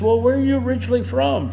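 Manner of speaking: a high, quick, snooty question; smug. Acted. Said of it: "a smug attitude".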